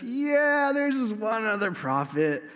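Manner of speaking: high, drawn-out grudging yeah; mock reluctant admission. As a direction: imitate a king grudgingly admitting it. King reluctantly admits another prophet.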